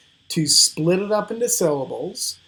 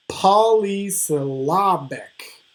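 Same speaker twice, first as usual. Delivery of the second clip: slow Polysyllabic as POL-y-syl-LAB-ic; stressed syllables shown; 3 semitones higher, slower than usual. Shown as a demonstration. Teacher demonstrates scansion, Polysyllabic slow with POL and LAB stressed. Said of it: "those syllables that receive the stress".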